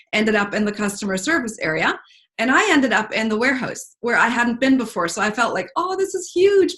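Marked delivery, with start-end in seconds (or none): 5.8-6.7 amazed thought. Retold or imitated. Retold own amazed thought.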